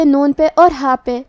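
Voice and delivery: ordinary delivery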